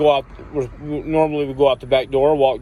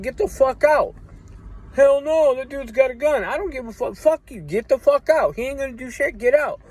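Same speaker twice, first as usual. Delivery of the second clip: furious high-pitched shouting; angry high yelling; 12 semitones higher than usual. Retold or imitated. Telling the friends who set him up to leave, angry high yelling. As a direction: run them off, voice way up.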